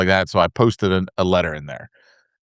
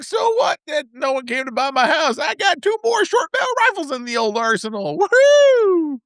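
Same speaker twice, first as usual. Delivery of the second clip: much higher, whooping with glee; overjoyed. Retold or imitated. Rifle approvals arrive after the sale falls through, gleeful whoop. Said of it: "I was all happy again".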